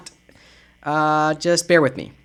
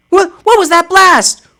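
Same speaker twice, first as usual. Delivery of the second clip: higher, louder alarmed cry; startled by a sudden blast; 9 semitones higher, 4 dB louder than usual. Acted. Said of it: "I'm doing different voices for different people".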